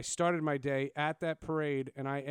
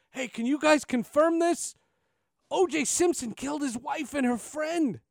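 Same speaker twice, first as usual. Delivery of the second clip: high, loud panicked voice; panicked caller; 12 semitones higher, 7 dB louder than usual. Retold or imitated. Trader calling an intern in a panic, high and loud. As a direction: blurt it out like a frantic trader. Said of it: "calls up in a panic".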